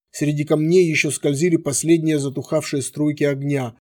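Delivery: plain reading voice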